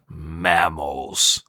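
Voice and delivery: low, soft, sneering single word; disdainful Mr. Krabs. Retold or imitated. Krabs sniffing Sandy, low disgusted mutter. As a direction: growl it low with contempt. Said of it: "he goes".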